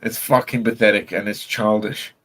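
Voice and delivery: normal talking voice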